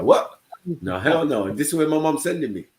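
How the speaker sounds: loud, high disbelief rising to a cry; shocked protest. Retold or imitated. Told of his new school, a loud high outraged kid. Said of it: "I was like".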